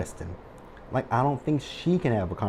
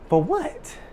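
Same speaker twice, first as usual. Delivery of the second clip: exasperated. Real, not acted. Outraged at Hayden's scheming.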